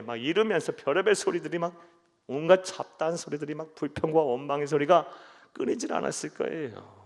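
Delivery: ordinary delivery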